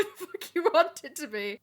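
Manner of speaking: laughing through the quote. Retold or imitated. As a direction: giggle mid-sentence. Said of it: "Edgeworth was like".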